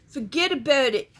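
a Southerner's hammed-up northern accent on Forget about it; mock northern accent. Southern vlogger jokingly trying a northern accent: mock New York Forget about it. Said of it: "pretend like I can do an accent from up north".

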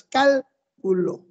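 "cálculo" slowly split, CAL, then culo; stress on first syllable. Shown as a demonstration. Teacher showing where the tilde goes, slow "cálculo" stressed on CAL. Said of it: "Debería llevar tilde en la A".

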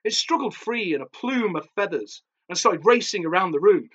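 ordinary delivery

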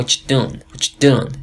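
"what you doing" squeezed into "wacha dun". Shown as a demonstration. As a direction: drop the -ing of "doing" and join "what you" with a ch. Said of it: "بخوایم آمریکایی ترش کنیم".

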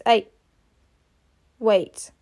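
ordinary talk, nothing marked

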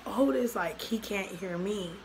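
ordinary delivery, nothing marked

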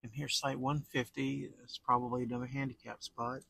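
usual speaking voice

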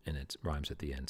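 ordinary delivery

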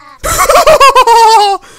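loud creepy witch cackle; creepy laugh. Acted. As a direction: cackle with wicked glee. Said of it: "laughing in a creepy voice".

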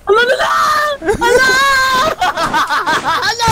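panicked cries. Real, not acted. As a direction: cry out in real game panic. Panicked cries as game danger hits. (nataranta nga singgit)

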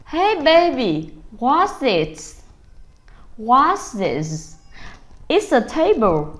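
put-on drawn-out cheerful questions to a toddler; upbeat parent. Parent quizzing baby about the table, sing-song. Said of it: "Thì chúng ta sẽ nói là".

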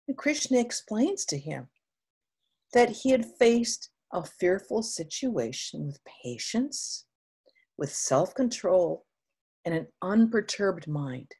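ordinary delivery

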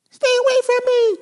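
very high, fussy little bird voice. Acted. Oxpecker telling her love about the banana peel, high and fussy. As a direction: play the upset little oxpecker.

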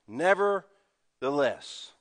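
drawn-out nevertheless, slowly spoken; slow emphasis. Shown as a demonstration. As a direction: stretch nevertheless out. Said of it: "that's a transition word".

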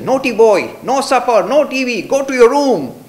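loud, high stern telling-off; scolding father. Retold or imitated. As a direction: bark it like an angry dad. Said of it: "waved his finger at me and said".

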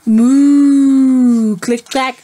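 loud, drawn-out cow moo. Acted. Teacher reading to kids, loud cow moo.

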